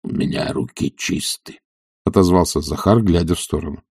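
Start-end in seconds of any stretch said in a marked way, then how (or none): 0.0-1.6 low, husky, softer retort; sullen servant. A narrator's reading. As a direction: grumble back under the breath. Servant sullenly answers master's scolding.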